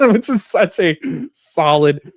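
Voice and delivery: laughing high through the words. Real, not acted. Podcaster amused by Jimmy's museum line, laughing. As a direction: break into a laugh mid-thought.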